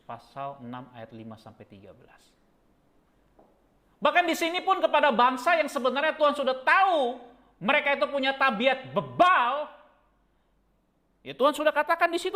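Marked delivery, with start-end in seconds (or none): 4.0-12.3 loud, high fervent preaching; preaching at full force. Real, not acted. Preacher describing a stubborn nation, loud fervent preaching. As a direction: thunder the point home.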